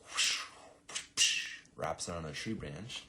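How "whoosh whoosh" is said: loud, airy whooshes; whooshing sound effects. Retold or imitated. Storyboard artist imitating a swing through forest, airy whooshing. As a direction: voice the swish of fast movement.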